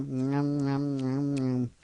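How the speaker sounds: low-key nom-nom chewing sounds; soft, droning munch imitation; munching noises. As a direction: imitate munching, softly and evenly. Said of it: "eating an apple".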